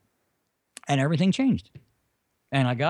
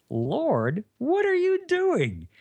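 much higher, slow, otherworldly voice; another inner voice; 10 semitones higher, much slower than usual. Retold or imitated. A second voice questions him while praying, high and slow. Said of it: "And then another voice".

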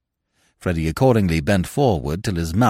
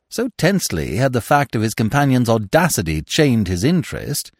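plain reading voice

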